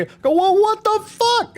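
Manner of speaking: ordinary talk, nothing marked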